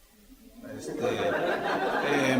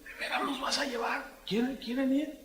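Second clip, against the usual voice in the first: put-on higher, light child voice; kids excited; 7 semitones higher, 3 dB quieter than usual. Children ask their father about the trip.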